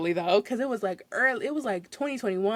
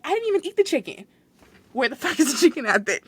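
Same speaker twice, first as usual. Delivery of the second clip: outraged customer; 4 semitones higher, 4 dB louder than usual. Retold or imitated.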